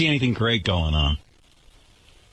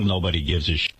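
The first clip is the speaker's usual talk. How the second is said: dropped much lower, a muttered aside; low dismissive mutter; 7 semitones lower, faster than usual. Shown as a demonstration. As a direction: toss it off low under your breath. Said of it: "Give him a channel".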